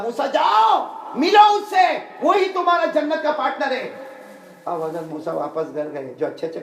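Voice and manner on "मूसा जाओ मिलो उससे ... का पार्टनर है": high, loud proclamation; booming command. Retold or imitated. Allah sending Musa to meet his partner, loud command. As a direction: call out the order forcefully, high and loud.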